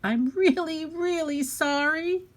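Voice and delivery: put-on high, pleading child voice; crying out apology. A narrator's reading. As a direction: plead for forgiveness as an upset little girl. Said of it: "Harriet cried".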